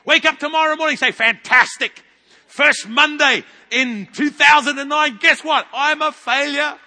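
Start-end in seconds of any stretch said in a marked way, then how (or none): 1.1-6.8 slow, exaggerated cheer; mock cheerful declaration. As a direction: announce your failure with glee.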